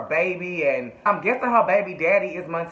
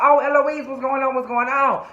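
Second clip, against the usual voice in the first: high, raised-voice imitation of a crowd; excited mock clamor; 8 semitones higher, 4 dB louder than usual. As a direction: pipe up high and loud, mock-eager. Crowd greeting Eloise, acted.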